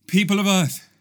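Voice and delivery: solemn visitor from another dimension, growing urgent; lightly voiced alien messenger. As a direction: warn the people of Earth before you explode.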